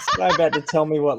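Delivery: talking through a laugh; half laughing the words out. Real, not acted. Laughing at influencer joke.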